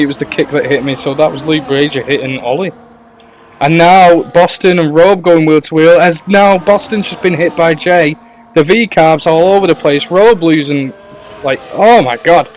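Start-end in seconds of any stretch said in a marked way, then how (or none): 3.6-12.5 loud, high live race call; excited play-by-play. Real, not acted. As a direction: call the action as it happens. Live race commentary, wheel to wheel.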